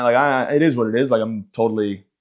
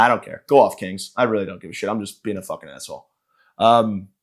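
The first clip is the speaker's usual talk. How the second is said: normal talking voice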